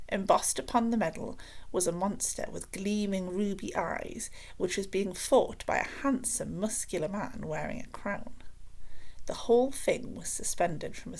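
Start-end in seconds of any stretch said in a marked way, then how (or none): none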